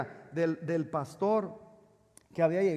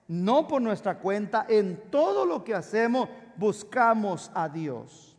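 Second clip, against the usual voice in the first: emphatic, raised preaching voice; 4 semitones higher, 6 dB louder than usual. Acted. Pastor urging his church to keep hope, emphatic preaching. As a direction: preach it out strongly, stretching key words. Preaches at full force to congregation.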